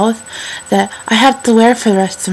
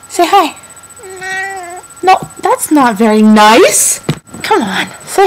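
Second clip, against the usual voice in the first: talking to a pet; 9 semitones higher, 3 dB louder than usual. Real, not acted. Coaxing her cat to greet viewers.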